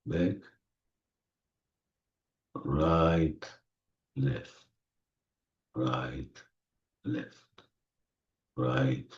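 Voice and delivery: soft, low, fading almost to nothing; soothing hush. Real, not acted. Guide leads closed eyes right and left to relax, soothing hush. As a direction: lull them with hushed, spaced-out words.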